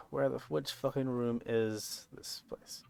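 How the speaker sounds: whispered aside to himself; whispering. Real, not acted. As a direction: mutter it under your breath. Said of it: "unvoiced muttered aside".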